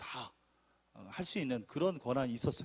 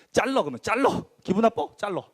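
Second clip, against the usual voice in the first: louder, higher defiant snap at the boss; defiant retort; 5 semitones higher, 8 dB louder than usual. Retold or imitated. Arguing with the boss in his office, defiant. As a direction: dare him to fire you. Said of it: "그랬어요".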